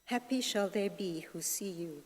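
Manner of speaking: ordinary talk, nothing marked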